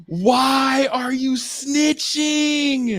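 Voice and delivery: mock outrage. Real, not acted. Laughing at snitch on video.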